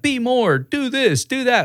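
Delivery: high-pitched imitation of vague advice-givers; mock preachy advice. Imitating people who always give advice, mockingly preachy.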